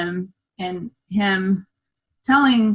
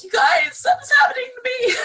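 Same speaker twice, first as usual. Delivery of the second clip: much higher, laughing and crying at once; retold alarm; faster than usual. Telling the group how she called out, high laughing cry. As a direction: cry out to the room, half laughing, half sobbing. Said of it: "And I was like".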